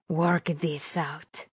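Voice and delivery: slow, soft villain's sneer; mocking echo. A narrator's reading. Villain laughing at captive's offer, mocking echo. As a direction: echo it back slowly with quiet scorn. Villain mocks the hero's words.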